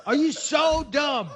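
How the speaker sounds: quoting a film character with mock scorn; movie quote. Co-host mocks the listener with a film quote, slow and high. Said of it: "What movie is that?".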